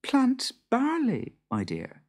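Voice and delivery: high, quick sly farmer's wife; Beth's voice. Acted. Beth slyly asking the Boggart, high and brisk. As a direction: tease him with the question.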